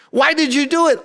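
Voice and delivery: very high mock interrogation; exasperated demand. Someone questioning an adult's actions, exasperated and high. Acted grown-up demanding a reason.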